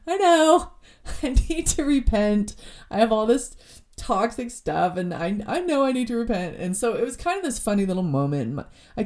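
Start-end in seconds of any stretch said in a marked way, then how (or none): 0.1-6.6 giggling through a mock confession, pitch jumping up; laughing it off. Telling God she's caught out, laughing. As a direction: laugh while admitting it, caught out. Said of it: "I started cracking up laughing".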